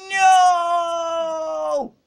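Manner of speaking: loud high anguished no; a sudden high cry of dismay; shouted no. Real, not acted. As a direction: yell it as you lose. Game failure outcry.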